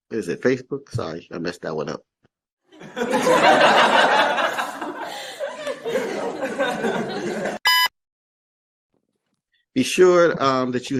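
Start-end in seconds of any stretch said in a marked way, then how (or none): 2.8-7.9 long high burst of laughter; embarrassed laugh. Real, not acted. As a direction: laugh it off after a slip. Laughs after messing up words.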